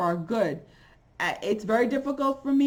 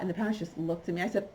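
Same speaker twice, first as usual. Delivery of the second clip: usual speaking voice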